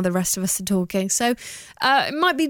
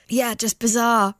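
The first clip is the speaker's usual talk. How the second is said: usual speaking voice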